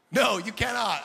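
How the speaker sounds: loud, emphatic comic rejection; mock refusal. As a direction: refuse loudly, as if cornered. Acted refusal of unwanted prayer.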